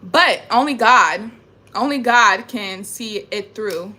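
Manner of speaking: slow, loud, deliberate "only God"; "only God" langsam und laut betont; solemn emphasis. Real, not acted.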